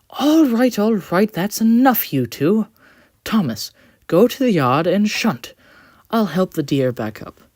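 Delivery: high, loud firm intervention; calm but firm. Acted. Quincy stops Thomas and the coach, firm and high. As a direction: break up the fight with authority. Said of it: "He spoke calmly but firmly".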